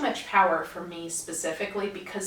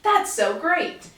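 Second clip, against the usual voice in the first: put-on higher, louder sing-song kid greeting; talking to children; 8 semitones higher, 5 dB louder than usual. Greeting kids in costume at the park, higher and louder. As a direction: gush like greeting a small child. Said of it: "I tend to talk to children".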